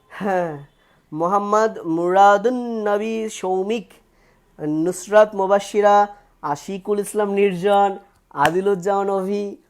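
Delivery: ordinary talk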